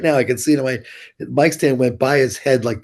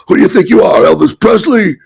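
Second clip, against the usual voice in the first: sneering shout, much higher and louder; mocking shout; 8 semitones higher, 11 dB louder than usual. Bouncer yells at the guitarist who kicked the mic stand, sneering shout. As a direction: yell it at the kid like a furious bouncer. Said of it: "he goes, he goes".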